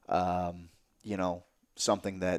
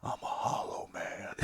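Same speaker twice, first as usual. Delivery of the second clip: slow, hollow whisper; empty whisper; 8 dB quieter, slower than usual. Retold or imitated. I answer cheerful Steve, slow hollow whisper. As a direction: let it fall out flat and lifeless, slowly. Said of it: "And I'm like".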